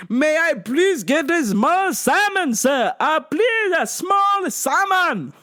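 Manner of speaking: high, slow, overdone pleading; mock dad ordering in big drawn-out swoops; demanding dad. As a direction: stretch each plea out in a high dad voice. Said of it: "my dad's, like, shoving the menu".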